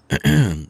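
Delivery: short, high-pitched throat clear. Acted. Trainee about to show winning hand, throat clearing. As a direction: clear your throat before the reveal.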